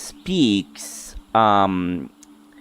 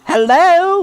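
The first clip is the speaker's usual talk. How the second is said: put-on very high childlike fairy calling; 5 dB louder than usual. Fairy waving at the silent stranger, very high calling voice. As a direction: pitch way up, call out and wait.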